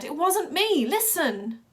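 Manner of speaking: high, loud pleading; desperate yell. Acted. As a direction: shout your innocence. Desperate denial.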